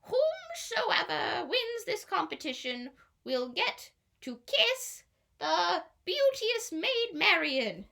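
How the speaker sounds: slow, high proclamation of a king; pompous king. A narrator's reading. King proclaiming the contest prize to the crowd, slow and lofty. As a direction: announce it grandly like a royal herald. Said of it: "Bad King John says".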